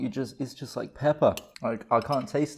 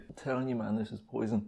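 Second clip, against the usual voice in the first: strained hush; 3 semitones lower, 12 dB quieter than usual. Real, not acted. Throat burning from spicy soup.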